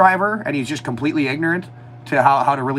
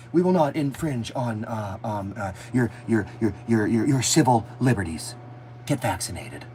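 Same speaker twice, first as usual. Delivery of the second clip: mocking politician; 5 semitones lower, 7 dB quieter than usual. Mocking the prime minister's stumbling speech ending. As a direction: stammer through it like a stalling leader. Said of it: "that's how he talks".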